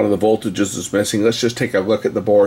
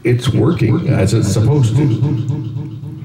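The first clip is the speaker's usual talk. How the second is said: echo effect; 3 semitones higher, slower than usual. Real, not acted. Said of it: "That's a little better".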